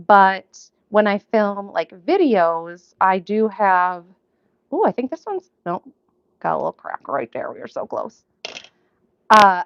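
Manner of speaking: ordinary delivery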